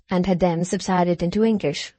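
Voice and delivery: plain reading voice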